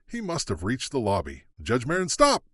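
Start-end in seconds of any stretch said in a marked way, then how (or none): 1.6-2.4 louder call to stop; shouting. Acted. The clerk shouts at the fleeing judge, louder call. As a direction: shout him down as he flees. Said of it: "Emily shouted".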